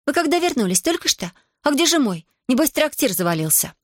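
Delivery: put-on high bright girl's voice, chatty; lively young woman teasing; cheerful village girl. A narrator's reading.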